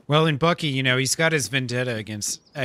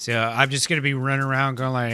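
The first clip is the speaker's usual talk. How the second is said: usual speaking voice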